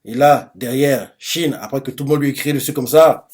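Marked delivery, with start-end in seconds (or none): none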